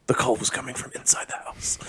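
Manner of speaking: spooky whispered movie line; horror whisper. Pretend.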